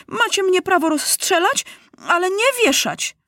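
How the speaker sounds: put-on very high boyish protest; indignant cry. A narrator's reading. Captured boy facing hanging, high defiant cry. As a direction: plead your rights, pitched right up. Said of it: "krzyknął Maciuś".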